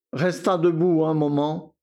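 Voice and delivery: plain reading voice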